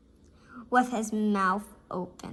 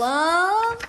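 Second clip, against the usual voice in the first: dramatic dread; 11 semitones higher, 5 dB louder, much slower than usual. A narrator's reading. Dreaded vegetable reveal.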